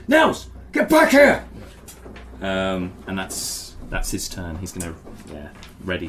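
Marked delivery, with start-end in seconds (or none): 0.1-1.5 high, loud shout, drawn out; shouted call. Acted. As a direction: yell a sharp order to come back. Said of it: "looks up and goes".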